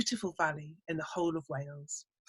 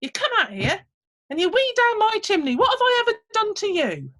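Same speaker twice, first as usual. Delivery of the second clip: very high, loud, outraged squeak; indignant little man; 12 semitones higher, 6 dB louder than usual. Acted. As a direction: tell him off from down by his boots. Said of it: "said the little man".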